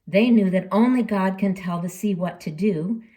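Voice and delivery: usual speaking voice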